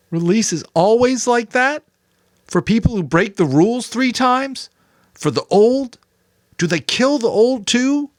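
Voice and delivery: put-on a boy near tears, crying out; upset boy, high and raw; Jonas, anguished. A narrator's reading. Jonas questions.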